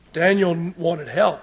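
ordinary talk, nothing marked

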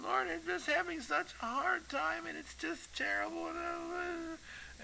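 high, soft pitiful whine; mock self-pitying whimper; whining. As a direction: imitate someone whining feebly to God. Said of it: "God gets tired of our whining".

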